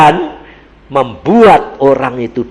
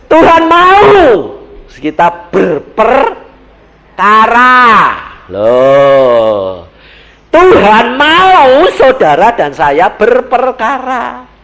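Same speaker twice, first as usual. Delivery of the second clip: slow emphatic preaching; 9 semitones higher, 7 dB louder, much slower than usual. Acted.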